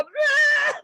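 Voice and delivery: loud high gaping open-mouthed sound; mouth full of air. Retold or imitated. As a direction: open wide as the wind hits.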